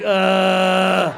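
long level groan of pain; hammed-up drawn-out "uhhh" of agony; mock pained groan. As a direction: groan as if doubled over. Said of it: "he's bent over in pain".

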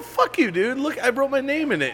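high, pushy mock outrage; Tony's mock-indignant claim. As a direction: insist the stolen hat is yours. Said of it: "He's like".

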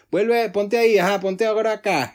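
loud, high-pitched instructor order; boxing coach command. Acted. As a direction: call it out like a coach to a beginner. Acted boxing coach giving orders.

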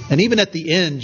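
normal talking voice

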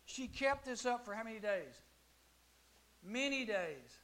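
ordinary talk, nothing marked

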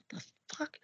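whispered disbelief, voiceless; stunned whisper. Retold or imitated. Telling listener of hearing a strange voice, stunned whisper. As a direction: breathe it out in a shocked whisper.

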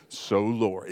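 very deep mock-profound prayer voice. Preacher mimics pious people praying, deep throaty prayer voice. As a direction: put it deep in the back of the throat. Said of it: "in the back of their throat or deeper".

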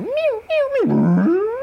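high, far-off mews; imitated cow mews. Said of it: "I hear the bull come in".

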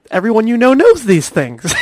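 normal talking voice

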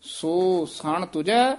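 ਸੋ ਸਣ ਤੁਝੈ very slow, with a rise on ਤੁਝੈ; spaced-out ਸੋ ਸਣ ਤੁਝੈ; slow demo. Shown as a demonstration. Teacher repeats the phrase slowly for the student. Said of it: "ਇੱਥੇ ਠਮਕ ਲੱਗ ਜਾਣੀ ਹੈ".

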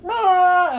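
very high loud startled yelp; startled scream. Retold or imitated. Knocked on the stall, a high loud startled scream. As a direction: shriek as if caught off guard. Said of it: "and it's like".

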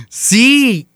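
very high, thrilled imitated yes; squeaky elated reply; excited confirmation. Mother's excited answer, re-enacted.

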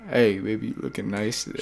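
low, soft smooth-talker come-on. Acted. Singer sweet-talking a date, silky and hushed. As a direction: croon it low and quiet, like a ladies' man. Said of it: "silky smooth voice".

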